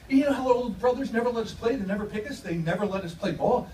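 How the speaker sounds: ordinary talk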